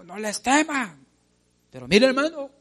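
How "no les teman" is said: higher, slowed rallying cry; lightly shouted "No les teman"; Joshua's shout. Retold or imitated. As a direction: call out like Joshua to his people. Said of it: "este Josué todavía gritó".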